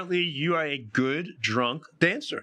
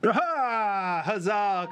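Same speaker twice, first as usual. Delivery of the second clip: cheering tavern crowd; 7 semitones higher, much slower than usual. Acted. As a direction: shout out a rowdy hooray.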